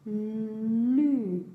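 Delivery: "lu" with a long, held L; syllable demonstration. Shown as a demonstration. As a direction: hold the L, then release "lu". (« lu » avec un L longuement tenu)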